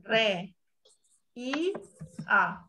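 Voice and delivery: "Re. I. A." split with pauses; syllable by syllable. Shown as a demonstration. Teacher shows the class the syllables, slowly split. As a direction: break "reía" into separate syllables. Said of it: "vocal acentuada de María?".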